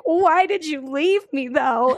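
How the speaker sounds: high, drawn-out plea; mildly hammed-up hurt question; plaintive girlfriend. Acting out her reproach to him, plaintive. Acts out Sarah's plaintive film line.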